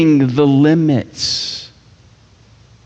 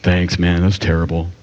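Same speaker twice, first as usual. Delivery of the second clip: mock ungrateful reply; 9 semitones lower than usual. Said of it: "Be like".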